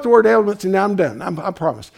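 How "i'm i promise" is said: ordinary talk, nothing marked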